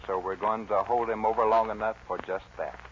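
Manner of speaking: ordinary talk, nothing marked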